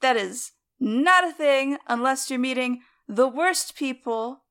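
normal talking voice

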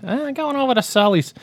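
high, put-on uncle voice; squeaky imitation of an uncle; John. Host redoing the uncle's line, high put-on voice. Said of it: "our mutual uncle John's".

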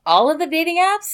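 high, stunned question; shocked disbelief; surprised. Real, not acted. Stunned reaction to friend's news.

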